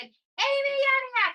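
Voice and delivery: called out over the studio; announcer calling name. Retold or imitated. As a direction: stretch the name out like a game-show announcer. Host calls her name out.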